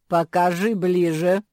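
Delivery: loud order in creature voice; commanding creature. A narrator's reading. Strange creature to Ninon about the pendant, commanding. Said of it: "велело странное создание".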